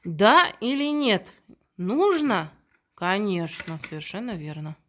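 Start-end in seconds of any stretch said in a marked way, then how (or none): 0.0-2.5 put-on slow, higher, louder child-directed question; reading to a toddler. Shown as a demonstration. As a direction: coax a little child to answer. Teacher quizzes a young child.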